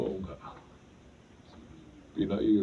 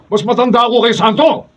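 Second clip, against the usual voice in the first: loud, much higher taunting yell; menacing shout; 18 dB louder than usual. Acted. Bully Mulong threatens Oreng with a beating, shouting high. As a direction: sneer and threaten at full volume.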